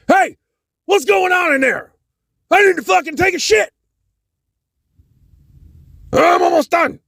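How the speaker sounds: impatient crewman demanding the bathroom; impatient crew guy. Retold or imitated. Crew guy banging on the bathroom door, high and impatient.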